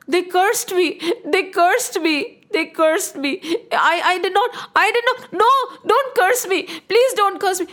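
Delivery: panicked king, high and loud pleading; shouting like madman. Acted. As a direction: beg frantically against the curse, pitch climbing. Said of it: "Raja Dashrath was shouting like a madman".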